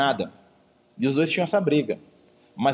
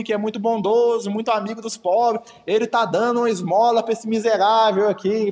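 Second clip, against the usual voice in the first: trumpeting herald; 5 semitones higher than usual. Retold or imitated. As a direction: blare it to the whole street. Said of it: "E aí trombeteava pra todo mundo".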